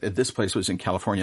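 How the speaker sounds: usual speaking voice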